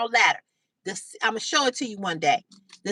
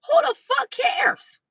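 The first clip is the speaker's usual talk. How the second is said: much higher and louder, slow and drawn out; angrier repeat; 12 semitones higher, 3 dB louder, slower than usual. Real, not acted. Repeating herself to the commenter, rising to a slow shout. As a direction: let it build into a near shout.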